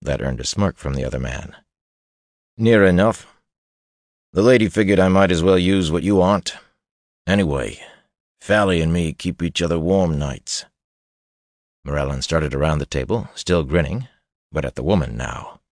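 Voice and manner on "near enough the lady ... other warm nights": smirking brute. Acted. Big man taunting rival.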